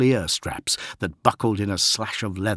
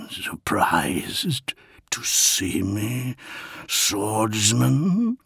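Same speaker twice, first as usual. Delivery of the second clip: slow gurgling undead whisper; gurgling swamp creature; much slower than usual. Acted. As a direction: breathe it out like a risen corpse. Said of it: "gurgled Eidolon".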